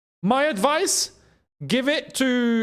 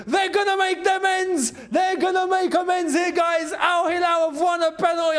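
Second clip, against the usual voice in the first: excited shouting; 11 semitones higher than usual. Real, not acted. Penalty awarded during live match.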